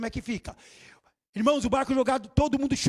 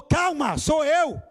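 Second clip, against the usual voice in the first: loud, higher call over the storm; raised voice of the master calling out; calling out calmly; 4 semitones higher, 5 dB louder, much slower than usual. Retold or imitated. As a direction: reassure them across the waves, slowing at the end.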